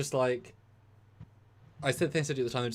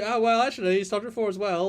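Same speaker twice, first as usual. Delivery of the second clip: higher, louder smug pedant, falling on well; mock well actually, pushed further; 8 semitones higher, 5 dB louder than usual. Pressing his correction on the co-host, exaggerated smug pedant. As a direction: lean even harder into the know-it-all correction.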